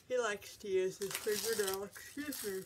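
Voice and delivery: words stretched by a real yawn; talking through a yawn. Real, not acted. Host showing the iPad case excuses herself, yawning. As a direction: yawn while you keep talking. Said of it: "for the yawn".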